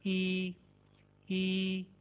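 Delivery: "ई" held long, like double e in feet. Shown as a demonstration. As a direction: stretch "ई" as in feet. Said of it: "sound जितनी लंबी होती है". ("ई" लंबा, फीट जैसा)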